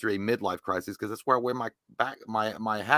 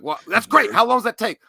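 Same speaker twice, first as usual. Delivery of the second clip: mock excited boss; 8 semitones higher, 8 dB louder than usual. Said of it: "And he's like".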